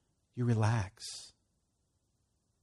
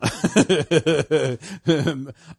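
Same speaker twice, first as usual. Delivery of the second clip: hearty laugh; 4 semitones higher, 9 dB louder than usual. Real, not acted. Preacher laughs.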